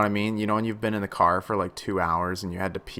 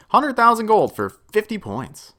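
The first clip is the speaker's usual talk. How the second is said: much higher, louder disbelief at the price; incredulous outcry; 12 semitones higher, 7 dB louder than usual. Real, not acted. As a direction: exclaim at the absurd cost. Outraged at the cost.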